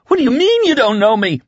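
high, frantic outrage; wild cry. A narrator's reading. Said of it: "he cried wildly".

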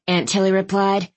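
ordinary delivery

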